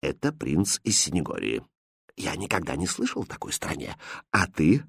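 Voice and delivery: king discreetly consulting his jester; quiet aside. Acted. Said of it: "тихо сказал". (тихо, по секрету)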